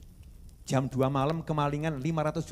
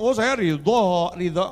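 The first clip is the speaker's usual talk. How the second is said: mock lip service; 6 dB louder, slower than usual. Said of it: "jangan cuma ngomong".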